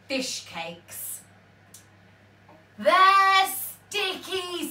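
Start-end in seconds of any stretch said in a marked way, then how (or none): 2.8-4.7 very slow, loud complaint; disgusted. A narrator's reading. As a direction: drag it out in disgust. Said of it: "said Ben Buckle".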